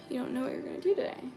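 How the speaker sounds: ordinary talk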